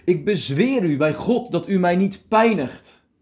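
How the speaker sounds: high, loud cry of desperate pleading; luide schreeuw. A narrator's reading. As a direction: cry out loudly, pitched well up. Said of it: "met luide stem schreeuwde hij". (luid en hoog uitgeroepen)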